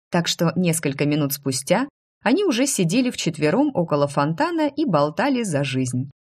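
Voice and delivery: ordinary delivery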